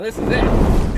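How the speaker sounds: loud, slow triumphant cry; triumphant shout. Acted. Johnny shouts to his pal as he bursts into flame. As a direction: roar it out as you ignite.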